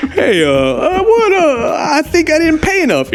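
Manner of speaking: high whiny fumbling voice; high nervous. Pretend. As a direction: stammer it up high, flustered. Voicing nervous customer.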